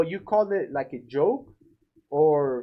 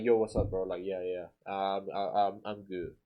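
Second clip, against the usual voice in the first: lower, softer, dull mumbled greeting; too serious; 7 semitones lower, 5 dB quieter than usual. Shown as a demonstration. Painter answers homeowner low and flat-voiced, trailing off quietly. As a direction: mumble it without any energy. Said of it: "very serious".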